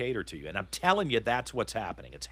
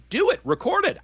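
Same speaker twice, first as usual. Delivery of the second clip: much higher and louder, daring them; defiant dare; 6 dB louder than usual. Real, not acted. To critics warning about recordings, a defiant shout. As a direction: throw the challenge out, defiant.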